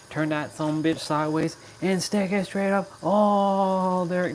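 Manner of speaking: lightly voiced Rock catchphrase, rising; The Rock impression. Streamer doing a light Rock impression for chat. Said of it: "That's The Rock, by the way".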